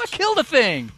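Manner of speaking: high, delighted cheer; excited cheer. Real, not acted. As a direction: celebrate the kill out loud. Celebrates killing a monster.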